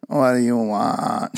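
slow creaky vocal fry; Valley girl vocal fry. Retold or imitated. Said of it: "She's doing that vocal fry voice".

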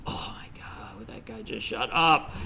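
exasperated whispered inner rant; frustrated whisper. Retold or imitated. Hearing the roommate sing down the hallway, frustrated whisper. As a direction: whisper it through mounting annoyance. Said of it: "this anger and frustration building up".

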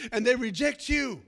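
ordinary delivery

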